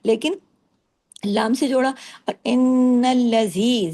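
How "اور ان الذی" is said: ان nasal and held, ذی stretched to two counts; slow recitation. Shown as a demonstration. Teacher shows students the two-count ذی, slow. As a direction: stretch ذی in الذی for two beats. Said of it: "زی کو دو حرکات".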